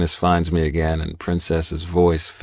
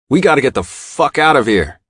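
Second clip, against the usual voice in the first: urgent shout to the guys; yelling; 8 semitones higher, 5 dB louder than usual. A narrator's reading. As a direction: shout it over gunfire. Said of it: "I yell to all the guys".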